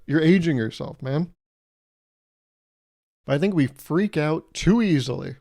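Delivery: normal talking voice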